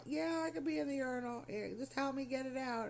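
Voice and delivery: high-pitched imitation of an old patient; older man. Older patient answers nurse about urinal, imitated old man. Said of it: "this older guy".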